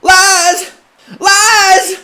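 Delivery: high, loud cry of denial. Acted. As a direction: shout it out desperately. Preacher denies accusations.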